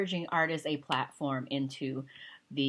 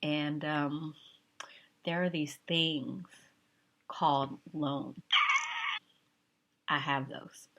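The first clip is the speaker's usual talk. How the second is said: whispered aside; slower than usual. Real, not acted. Confessing loans in asides.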